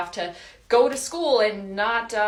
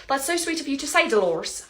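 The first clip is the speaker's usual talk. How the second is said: put-on a try at a Scottish Hagrid accent; 5 semitones higher than usual. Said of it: "that was Hagrid".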